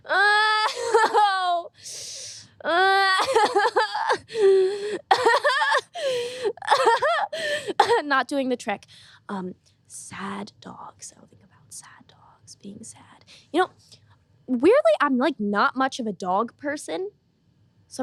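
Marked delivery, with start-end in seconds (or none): 0.1-8.0 loud, high mock sobbing wail; put-on crying, pitched way up; fake crying noises. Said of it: "make some crying noises". 9.3-13.2 very soft, slow murmur; hushed attempt at summoning tears; trying to feel sad. Willing himself to feel sad.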